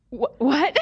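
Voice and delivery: a retold gasp of disbelief breaking into a laugh; stunned disbelief. Retelling the healed woman's reaction, stunned and laughing. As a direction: play her shock at standing without pain, half laughing.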